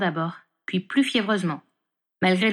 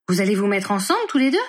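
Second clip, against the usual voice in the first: exclaimed in surprise; 4 dB louder than usual. A narrator's reading. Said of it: "s'écria-t-il".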